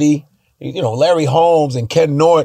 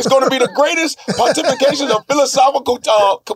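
much higher, shouted rambling sermon; 10 semitones higher than usual. Pretend. Singer hyping the fight, high and shouting. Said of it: "He's like".

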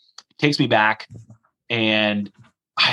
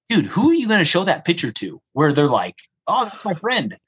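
higher, half-laughing disbelief; amazed he calls you a friend; incredulous protest; 7 semitones higher than usual. Retold or imitated. Guard taking selfie with prisoner.

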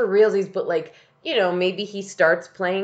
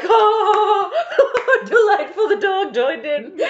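very high, loud crowd gush breaking into laughter; hammed-up squeal of delight, laughing; imitating delighted audience; 6 dB louder, slower than usual. Podcaster mimicking everyone at the dog joining in, laughing delight. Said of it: "And everyone's like".

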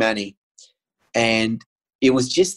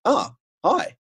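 higher-pitched surprised greeting; caught off guard; 12 semitones higher than usual. Retold or imitated. Patient answers oncologist's unexpected call, caught off guard. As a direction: answer as if not expecting this caller.

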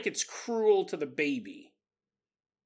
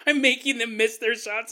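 ordinary talk, nothing marked

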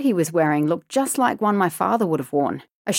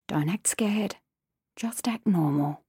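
tense inner whisper; 5 dB quieter than usual. Acted. Child's inner self-talk.

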